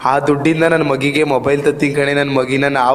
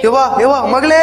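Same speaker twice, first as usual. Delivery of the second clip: anxious pleading; 10 semitones higher than usual. Acted. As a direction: beg her not to refuse food. Father pleads with sulking daughter.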